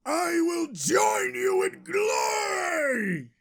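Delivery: high, drawn-out heroic shout; heroic battle cry. Acted. Hero calls after his idol running to sea, high triumphant shout.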